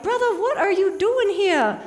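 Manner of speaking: delighted to meet a creature in the road; greeting a snapping turtle on the road, excited and laughing. Retold or imitated.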